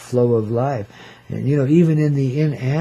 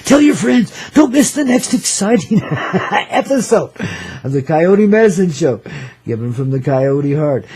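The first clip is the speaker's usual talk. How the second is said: radio announcer; 7 semitones higher, 7 dB louder than usual. Acted. Radio show promo plug.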